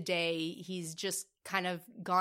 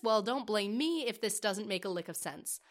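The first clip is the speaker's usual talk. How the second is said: usual speaking voice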